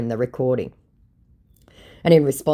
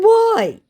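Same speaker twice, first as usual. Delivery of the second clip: "Why?" very high and loud; emphatic questioning; 9 dB louder than usual. Acted. Host challenging the scammer, "Why?" high and emphatic. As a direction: blurt "Why?" as an over-punctuated question. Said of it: "with four question marks".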